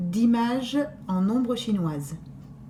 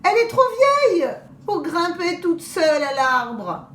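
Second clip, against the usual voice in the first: wolf playing grandmother; 7 semitones higher, 4 dB louder than usual. Wolf disguised as grandmother pleads.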